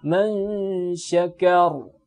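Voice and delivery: man syakar with the stopped final ra heavy; thick ra on pause. Shown as a demonstration. Teacher demonstrating waqf on syakar to the class: heavy final ra. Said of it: "Yang tebalnya bukan huruf K".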